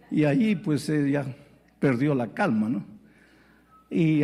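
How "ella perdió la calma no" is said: usual speaking voice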